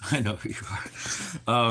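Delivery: teasing a chat viewer with mock menace; creepy hushed menace. Shown as a demonstration. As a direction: lean in and say it slow and spooky. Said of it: "So I know who you are".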